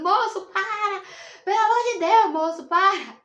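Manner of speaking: high-pitched pleading cry, imitating labour pain; retold screaming plea. Mother begging the doctor to stop during labour, imitated shouting. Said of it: "eu tava gritando".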